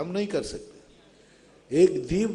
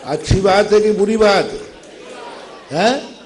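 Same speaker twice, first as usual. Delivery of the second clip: loud prodding question; 3 semitones higher, 6 dB louder than usual. Real, not acted. Teacher pressing his listeners on good or bad, loud and insistent. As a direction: call it out to rouse the listeners.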